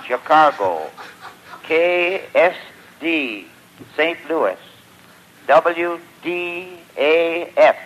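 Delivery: ordinary delivery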